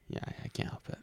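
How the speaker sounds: breathy whispered excuse; whispering. Real, not acted. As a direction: whisper it sheepishly.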